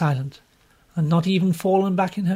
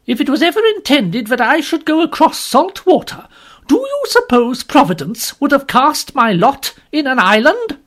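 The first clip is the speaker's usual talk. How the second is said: much higher, louder scornful refusal; scornful outburst; 10 semitones higher, 9 dB louder than usual. Acted. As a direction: scoff at the very idea. Woman scornfully refusing the voyage.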